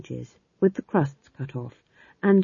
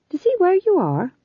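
curious question; 13 semitones higher than usual. Acted. Fool asks.

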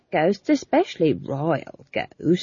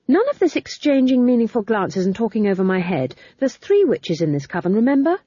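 fast, loud and insistent; quickly; 6 semitones higher, 4 dB louder, faster than usual. A narrator's reading. Young witch scolding the older two, quick. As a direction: rush it out before interruption. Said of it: "she added quickly".

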